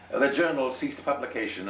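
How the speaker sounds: a dignified high reply; gentleman voice. Acted. Gentleman replies to sailor.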